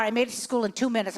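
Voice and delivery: normal talking voice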